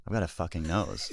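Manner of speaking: lightly put-on British accent. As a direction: say it as a waking British guy. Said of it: "what if I said that with a British accent".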